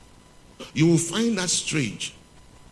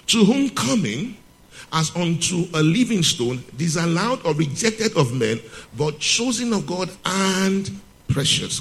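raised, slow scripture reading voice; 5 semitones higher than usual. Acted. Preacher reading the verse to the congregation, raised and slow. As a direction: lift the verse high and slow, like reading from the pulpit.

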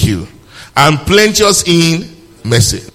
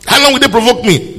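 much higher, offended complaint; provoked angel; 9 semitones higher, 3 dB louder than usual. Acted. Preacher mimicking the angel's irritated complaint. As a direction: whine it like a wounded angel. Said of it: "Does God speak like this?".

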